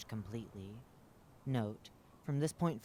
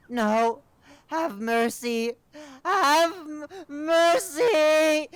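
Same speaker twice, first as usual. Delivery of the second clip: very high, loud crying scream; screaming creature; 18 dB louder, much slower than usual. Acted. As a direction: wail for mercy.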